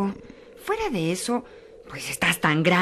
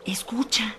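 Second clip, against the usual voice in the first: whisper; 3 semitones higher, 3 dB quieter, slower than usual. Acted. Listening for river.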